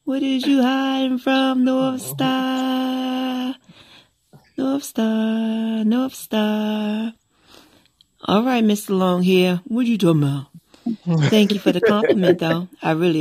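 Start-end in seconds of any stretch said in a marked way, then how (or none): 0.0-7.1 high, sing-song tease; teasing call. Pretend. Teasing North Star about hiding behind a mask, sing-song. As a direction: coax him out playfully.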